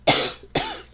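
a loud, rough cough; chesty cough. Real, not acted. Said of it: "I'm so sorry for the coughing".

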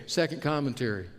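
ordinary delivery

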